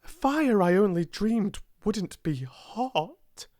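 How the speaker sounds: high, astonished exclamation; amazed cry. A narrator's reading. Girl startled by the fire's heat, amazed cry. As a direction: cry out in sudden discovery. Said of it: "she cried".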